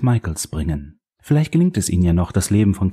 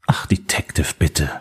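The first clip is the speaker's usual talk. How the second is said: usual speaking voice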